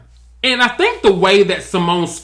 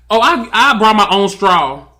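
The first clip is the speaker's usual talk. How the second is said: slow, mock-dainty guest voice, a touch of disdain; prissy guest voice; 2 semitones higher, 3 dB louder than usual. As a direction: play the finicky guest who brought her own straw. Fussy guest brings own straw.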